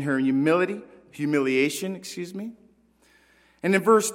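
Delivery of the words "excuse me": usual speaking voice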